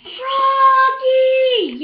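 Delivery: high, loud yell held long; dad's yell. A narrator's reading. Dad yelling to Froggy as the ball comes, loud long yell. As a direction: bellow his name from the sideline. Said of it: "yelled his dad".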